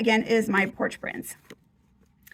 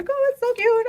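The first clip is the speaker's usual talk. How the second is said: delighted squeal; 13 semitones higher than usual. Real, not acted. Showing off finished craft page.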